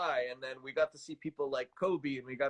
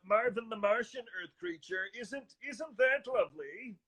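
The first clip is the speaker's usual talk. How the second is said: higher-pitched cartoon alien, slowing at the end; daintily pleased little cartoon alien, stretching the last words; Marvin the Martian; 5 semitones higher than usual. Shown as a demonstration. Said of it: "one of my favorites is".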